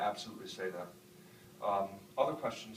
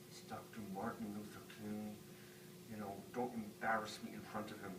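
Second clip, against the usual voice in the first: hushed warning; 11 dB quieter than usual. Retold or imitated. Father to his comic-reading kid before meeting Doctor King, hushed warning. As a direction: murmur it as a discreet warning.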